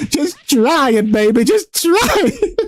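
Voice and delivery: high, slow teasing plea; coaxing sing-song. Retold or imitated. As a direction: coax them like a lover. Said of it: "I'm always like".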